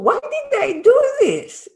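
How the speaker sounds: very high-pitched, astonished self-questioning; acted bafflement. Walker wondering at their own sudden jump, acted astonishment. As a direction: replay your own amazement, pitch leaping up.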